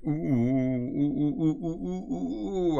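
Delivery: giddy "ooh-ooh-ooh" as the parcel arrives; excited oohing. Real, not acted. Hopes awaited package has arrived.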